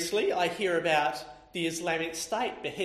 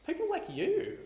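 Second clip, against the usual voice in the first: laughing; 12 semitones higher than usual. Real, not acted. Joke, laugh heard.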